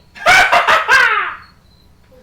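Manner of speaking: high, very loud burst of laughter; loud cackle. Acted. Game master as the commander, loud high cackle. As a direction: cackle at full volume.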